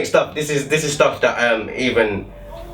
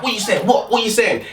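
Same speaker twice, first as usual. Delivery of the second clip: higher mock tough taunt; mock bravado; 8 semitones higher than usual. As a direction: taunt from the moving bus. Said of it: "I was like".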